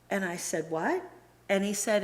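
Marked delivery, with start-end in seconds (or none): 0.7-1.0 higher, incredulous what; retold disbelief. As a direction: re-enact being taken aback. Retells second shock of the news.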